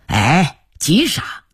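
casual brush-off, rising at the end; lightly voiced, genial shopkeeper waving off a debt; easygoing shopkeeper. A narrator's reading. Shopkeeper telling a father not to hurry, easygoing. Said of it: "掌柜的打了个哈哈说".

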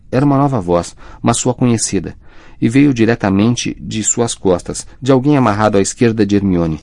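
usual speaking voice

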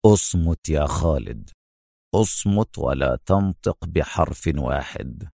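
low, slow, deliberate command; firm Adham. A narrator's reading. Adham silences Khaled sensing danger, low and firm. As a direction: order silence slowly and firmly. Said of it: "أجابه أدهم في حزم".

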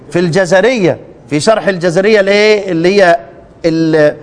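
normal talking voice

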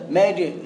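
مجري ending in a long "ē", between a and i; imala. Shown as a demonstration. Teacher pointing out the sound, imala on مجري. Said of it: "إيه بقى الصوت ده".

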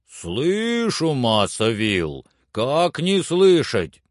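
slow, lilting call down; Jupiter answering. Acted. Servant assures master he hears, slow lilting call.

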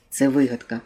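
usual speaking voice

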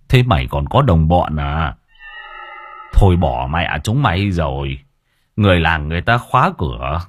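put-on weary old man brushing off the young thug; gruff old man. A narrator's reading. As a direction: wave him off like a tired old villager. Old man voiced in story.

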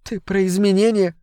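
sobbed. Acted. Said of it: "всхлипнула она".

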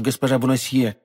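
ordinary delivery, nothing marked

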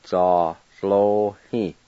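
"Za lo hi" very slow, every syllable stretched; slow model word. Shown as a demonstration. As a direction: drag out "Za lo hi" for the class. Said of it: "I'll go back and pronounce them".

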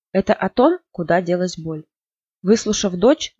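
ordinary delivery

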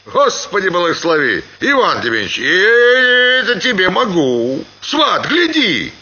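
high-pitched overlapping tavern cries; disorderly tavern voices. A narrator's reading. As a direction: toss out calls like rowdy peasants. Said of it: "раздавались беспорядочно голоса". (беспорядочные выкрики в трактире)

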